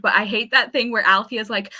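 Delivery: ordinary talk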